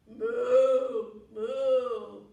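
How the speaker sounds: very loud, long sung moos; Mommy Cow's moo. Pretend. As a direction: moo loudly like a mother cow calling. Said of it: "gonna call 'em with a moo".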